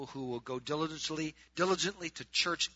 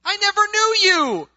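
shouted rejection; 16 dB louder than usual. Acted. The Lord repeating his rejection to churchgoers, full shout. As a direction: bellow it at the top of the range.

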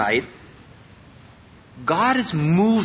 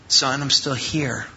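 gentle fatherly voice; 6 semitones lower, 3 dB quieter than usual. Retold or imitated. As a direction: speak like God gently calling a lost son home. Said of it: "spoke with gentleness".

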